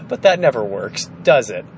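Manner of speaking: ordinary delivery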